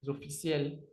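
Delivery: ordinary delivery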